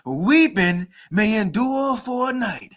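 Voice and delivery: slow, drawn-out mock church oratory; mock Kamala Harris. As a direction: read it as a teasing imitation of her speech. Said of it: "a little folly moment".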